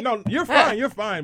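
loud, high teasing imitation of annoyance; mock mad sass. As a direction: raise the voice in pretend irritation. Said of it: "every time you get mad".

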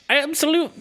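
aborted high, loud stab at Irish; attempted Irish accent. Co-host asked to read a review as an Irishman, attempted Irish accent. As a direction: start a squeaky, half-hearted Irish accent. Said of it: "read this review in your Irish accent".

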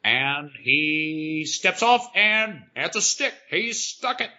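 play-by-play, drawing out the suspense before the landing; mock sports commentator.

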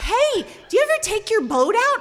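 loud, high, chummy hail; eager calling out. Retold or imitated. Dad asking a stranger about his boat, eager hail. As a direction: call over to him with keen interest.

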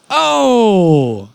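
high, loud drawn-out "oh"; long hooted oh. Pretend. Co-host clowning before the sandwich, long playful hoot. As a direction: hoot it out and let it slide down.

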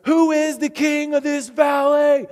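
high, loud, slow shouted question; rallying shout to the crowd; yelled call-out. Retold or imitated. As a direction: call it out across the mountain. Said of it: "he calls out again".

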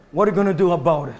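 ordinary talk

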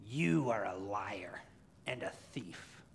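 slow, whispered creature accusation; Gollum. Acted. Preacher as Gollum needling Smeagol, menacing whisper. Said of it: "And Gollum keeps going".

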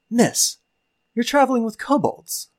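put-on a green young adventurer addressing a stranger; young knight's voice. Young adventurer greets the party, high and eager. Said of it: "He goes".

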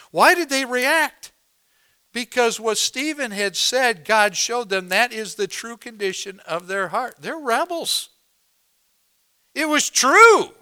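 high-pitched, emphatic sermon delivery; impassioned pulpit proclamation; forceful preaching. Acted. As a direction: preach it with rising force to a loud climax.

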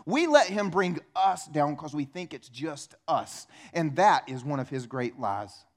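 usual speaking voice

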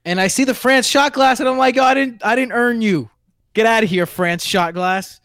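high, loud scolding of a shot glass; theatrical indignant rant, dragging out the final words; mock indignation. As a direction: banish it in comic high-pitched outrage. Acts out banishing a shot glass.

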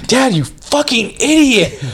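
high-pitched, drawn-out indignant rant; mock a spoiled son raging at his father; hammed-up bratty outburst; imitating Ross cussing out Dad. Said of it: "he would just be cussing out his dad".

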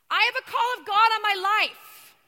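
put-on a nervous young woman yelling her calling out over the car; awkward yell. To the friends in the car, an awkward, loud blurted declaration. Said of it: "I yell out awkwardly in this car".

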